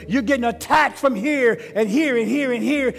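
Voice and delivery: loud, rising sermon cry; preaching at full force. Acted.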